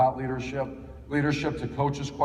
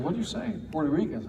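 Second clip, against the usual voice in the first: higher-pitched, mildly acted disbelief; incredulous; 6 semitones higher than usual. Coach questioning a player's mistake, mock incredulous. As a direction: retell a taken-aback question, pitch jumping up.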